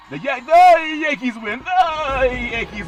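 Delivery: loud, high, drawn-out "The Yankees win"; baseball announcer voice. Pretend. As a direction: call the win like a radio announcer. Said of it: "my baseball voice".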